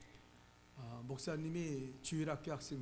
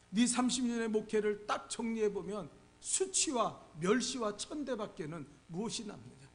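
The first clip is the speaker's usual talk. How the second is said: taunting devil; 5 semitones higher, 6 dB louder than usual. Retold or imitated. Devil quoted tempting the preacher.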